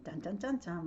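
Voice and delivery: usual speaking voice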